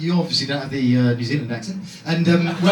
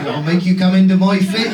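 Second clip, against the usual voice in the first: louder Dorset farmer come-on; Dorset accent; 6 dB louder than usual. Acted. Farmer in a sexual fantasy, loud thick Dorset accent. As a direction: push the mock seduction in broad Dorset, louder. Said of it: "It's not farmer fantasies".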